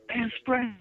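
very high-pitched mock girlish yell; little girl voice. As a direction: squeal it like a thirteen-year-old girl. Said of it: "thirteen-year-old little girl voice I had".

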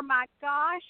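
usual speaking voice

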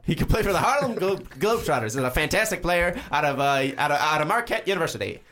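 put-on high, bright old-time radio announcer; 1950s announcer voice. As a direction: hype him up like a 1950s newsreel. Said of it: "You got a really good nineteen fifties announcer voice".

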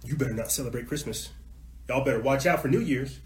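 ordinary talk

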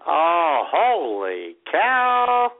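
ordinary talk

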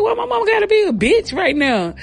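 high-pitched incredulous question; hammed-up indignant squeal; mock indignant protest. Acted protest at sister's insult.

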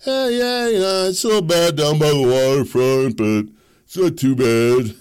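mimicking liberal friends shrugging it off; mocking liberal friends. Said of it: "They're like".

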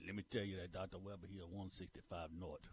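low, quiet Cajun coach impression; Ed Orgeron. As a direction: put on a low Louisiana Cajun brogue. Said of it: "fake Ed Orgeron".

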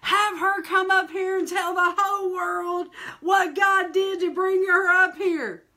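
high, loud call; hammed-up church mother's shout; mama shouting. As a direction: shout across the platform. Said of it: "she goes".

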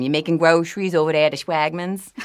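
light New Orleans yat accent, easy and chatty; a hint of yat, greeting a neighbour; casual New Orleans yat accent, lightly done. Shown as a demonstration. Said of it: "let me think about something very yat".

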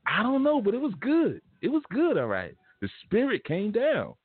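very high falsetto churchgoer impression; mock-ecstatic, high-pitched church member; gushing churchgoer. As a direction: squeal it like an overjoyed congregant.

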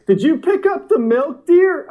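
high, loud mock-domestic question; mock spouse. Acts out couple using new catchphrase.

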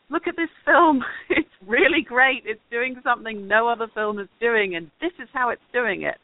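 excited show-and-tell, lifted voice; enthusiastic pitch. Acted. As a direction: sell it like a proud film pitch. Acts out pitching a film.

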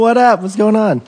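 loud, high, hyped hello; excited greeting. Real, not acted. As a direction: burst in with big energy. Guest greeting loudly.

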